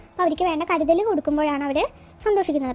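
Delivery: ordinary talk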